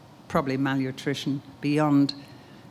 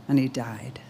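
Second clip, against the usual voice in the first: whispered, breath only; broken whisper; 3 semitones lower than usual. Acted. Widow to the prophet over her dead son, whispering. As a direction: let the words collapse into a whisper. Widow's child has died.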